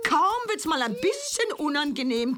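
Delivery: ordinary talk, nothing marked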